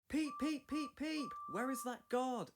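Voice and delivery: high, loud impatient peeps; anxious whistling. A narrator's reading. Edward waiting at the station for the late guard, high loud anxious peeps. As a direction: whistle hard, then demand the guard. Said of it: "Edward was getting anxious".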